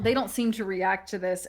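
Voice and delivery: normal talking voice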